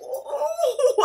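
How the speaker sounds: high, put-on gasp of shock; hammed-up surprise; mock shocked ooh. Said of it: "And I was like".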